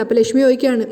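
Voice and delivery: ordinary delivery, nothing marked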